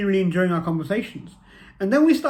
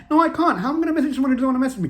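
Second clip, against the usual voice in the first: much higher, louder, fast exasperated refusal; panicked protest; 9 semitones higher, 5 dB louder, much faster than usual. Retold or imitated. Refusing his friend's push to message her, high, loud and rushed. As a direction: blurt it out in a flustered rush.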